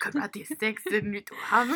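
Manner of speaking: mock Russian voice; fake Russian accent. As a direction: lightly attempt a Russian accent. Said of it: "like a Russian accent".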